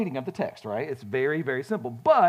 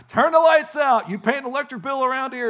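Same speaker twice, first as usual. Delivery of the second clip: high, loud retold yell; dad's yell; 8 semitones higher, 6 dB louder than usual. Dad yelling at the son about the lights left on, loud mock yell. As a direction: bark it like an angry dad. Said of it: "He would yell".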